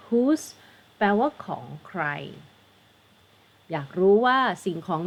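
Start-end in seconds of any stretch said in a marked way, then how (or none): 0.1-0.4 "Whose" begun with an H sound; pronunciation demo. Shown as a demonstration. Teacher repeating the word after explaining it, H-sound demo. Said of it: "อันนี้เสียงเหอะเหมือนกันนะ".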